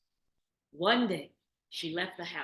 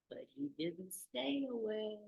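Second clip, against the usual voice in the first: usual speaking voice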